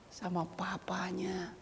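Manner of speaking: slow whispered answer. Acted. Preacher whispering the answer to the congregation. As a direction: answer in a hush.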